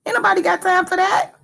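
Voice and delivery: high, fast sassy quote; meme catchphrase. Retold or imitated. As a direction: toss off the meme line.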